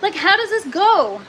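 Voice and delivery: high, loud, baffled question; exasperated. Real, not acted. Streamer asks viewers how the lid goes, high and exasperated. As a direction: puzzle over it, loud and exasperated.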